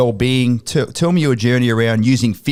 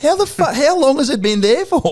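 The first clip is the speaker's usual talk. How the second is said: astonished. Retold or imitated. In the boardroom, amazed it was so close, high and incredulous. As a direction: squeak it out in disbelief.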